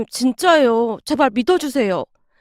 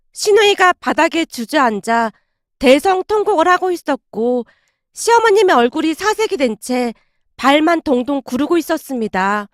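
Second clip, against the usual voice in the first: ordinary talk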